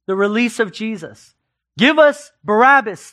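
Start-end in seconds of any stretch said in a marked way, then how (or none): 1.8-3.1 raised, insistent crowd cry; crowd demanding. Retold or imitated. Preacher voicing the crowd before Pilate, raised demand. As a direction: voice the mob calling for Barabbas. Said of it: "they said".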